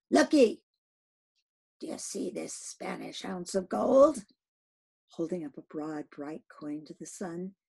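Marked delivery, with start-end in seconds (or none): 0.1-0.6 loud barked command; sudden call. Acted. Captain shouts for his crew's attention. Ahab calls crew to attention. 1.8-4.3 commanding captain's voice, rising to a cry; rally the men with the gold; Ahab addressing the crew. Acted. Captain calls to his crew, building to a shout.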